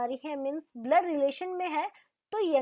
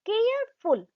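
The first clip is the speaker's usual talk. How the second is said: Careful said in two parts, higher pitched; split into parts; 7 semitones higher, 3 dB louder than usual. Shown as a demonstration. Teacher demonstrates syllables to the class, higher and split. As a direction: break careful into two beats, a little higher. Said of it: "एक साथ नहीं बोला".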